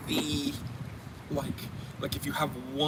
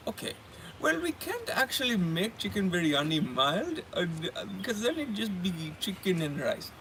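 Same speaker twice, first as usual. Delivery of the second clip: put-on higher Indian-accented explanation; imitated Indian accent, pitched up; 4 semitones higher than usual. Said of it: "I'm gonna do the accent".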